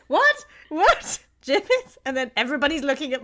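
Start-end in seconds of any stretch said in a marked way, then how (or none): 0.1-2.0 much higher, loud, rising questions; mock surprise. Imitating everyone puzzled by the word gibbets, high and loud. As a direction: echo the word in disbelief. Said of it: "everyone's like".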